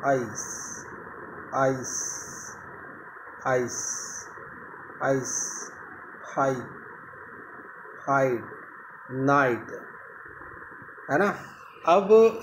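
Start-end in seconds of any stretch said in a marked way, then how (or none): 0.1-5.3 "आइस" the Indian way, stress on the ई; Indian English "ice". Shown as a demonstration. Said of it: "Indian जब भी आई बोलते हैं तो stress वहाँ पर होगा ई पर". 6.3-9.7 "हाइड", "नाइट" the Indian way, ई stressed; Indian English "i". Shown as a demonstration. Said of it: "Indian जब भी आई बोलते हैं".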